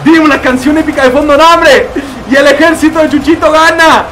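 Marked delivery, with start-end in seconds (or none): 2.3-4.0 triumphant announcement. Pretend. Announcing the army's win.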